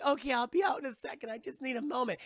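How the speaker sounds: high, hurried reply; acted nervous excuse; flustered stalling. Voices frightened Francesca through door.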